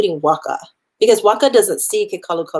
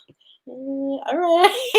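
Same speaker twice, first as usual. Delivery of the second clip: slow, high, coy okay; coy agreement; 7 semitones higher, slower than usual. Retold or imitated. Heroine coyly agreeing to run away with him, slow and high. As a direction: give a shy, dreamy yes.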